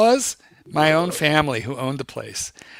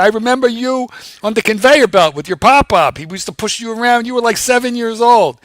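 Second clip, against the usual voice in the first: imitated old acquaintance, higher and louder; retold old factory man; 8 semitones higher, 4 dB louder than usual. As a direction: exclaim it as the man who remembers the boy. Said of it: "He said".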